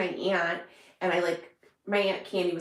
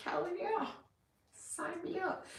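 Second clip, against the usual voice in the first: much higher eager cheer that stays soft; eager mock cheer; 11 semitones higher, 9 dB quieter, slower than usual. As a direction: squeal it with eager delight. Reacting to true crime shows.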